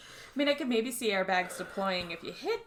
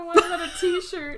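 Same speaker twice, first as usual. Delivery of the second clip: laughing; 7 semitones higher, 6 dB louder than usual. Real, not acted. Laughing at friend's line.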